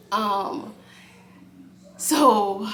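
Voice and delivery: ordinary talk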